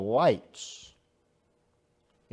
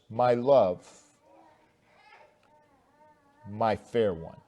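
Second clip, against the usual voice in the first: tender, loving; 4 semitones lower, 5 dB quieter than usual. Shown as a demonstration. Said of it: "is loving, kind".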